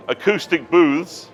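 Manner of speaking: normal talking voice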